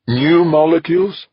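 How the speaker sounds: high, loud, incredulous question; angry disbelief. A narrator's reading. Westfall reacting to Devlin's explanation, angrily incredulous. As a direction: throw it back at him, pitched high.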